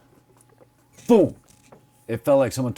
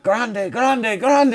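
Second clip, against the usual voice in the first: rising, louder chant of grande; excited mock Spanish; 10 semitones higher, 4 dB louder, much slower than usual. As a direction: coworkers marvelling at the huge bee, excited calling. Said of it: "They're like".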